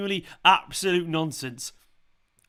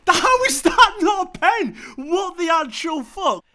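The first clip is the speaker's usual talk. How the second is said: high, loud, raging disbelief; real furious yelling at a referee decision; furious shout; 10 semitones higher, 7 dB louder than usual. Real, not acted. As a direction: yell at the screen in outrage. Furious fan at denied penalty.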